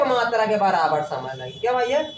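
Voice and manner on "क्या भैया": normal talking voice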